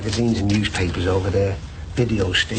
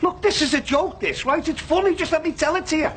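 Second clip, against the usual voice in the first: exasperated. Acted. Frustrated joke-teller insisting.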